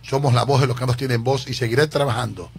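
ordinary delivery

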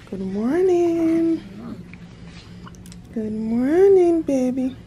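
cooing good morning to a newborn. Real, not acted. Greeting newborn in baby talk.

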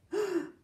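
sharp high gasp of wonder; storyteller's gasp. A narrator's reading. Grandfather remembering his buttons, marvelling gasp. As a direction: gasp before a grand boast.

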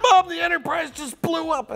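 put-on high, loud childish excitement; excited kid. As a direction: blurt it out like a kid. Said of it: "I said".

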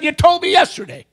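high, loud acted greeting; forward elderly woman. Elderly woman approaching a gentleman, high loud greeting. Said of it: "She said".

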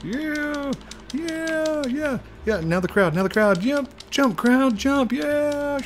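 very high, loud cheering; hyped crowd voice. Pretend. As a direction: pump it up like a stadium crowd.

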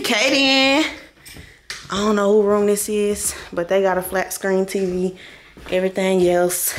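ordinary delivery

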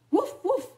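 two high, loud imitated woofs; storybook dog alarm; alarmed dog bark. A narrator's reading. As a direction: bark urgently to warn. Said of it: "Lady continued to bark in alarm".

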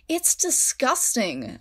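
high, slow spat disgust; slow, high disgusted spit. A narrator's reading. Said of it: "America spat".

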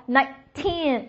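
put-on "nineteen" with a long, strong "teen"; stress on TEEN. Shown as a demonstration. Teacher drilling teen numbers, stress on the last syllable. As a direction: push hard on the end of "nineteen". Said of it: "往后使劲儿啊".

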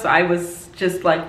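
ordinary delivery

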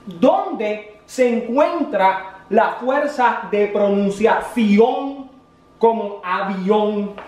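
drawn out slowly, word by word; teacher stretching each word for a slow pupil; slow teacher emphasis. Shown as a demonstration. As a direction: spell the question out slowly like a patient teacher. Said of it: "Es decir".

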